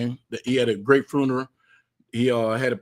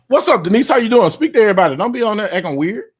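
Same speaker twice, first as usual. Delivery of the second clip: loud greeting; 9 semitones higher, 8 dB louder than usual. Real, not acted. Greeting guest joining the stream.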